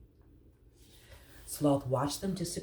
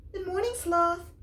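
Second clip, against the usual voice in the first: much higher calling voice; 3 dB louder than usual. Acted. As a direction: call up from below. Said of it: "she called".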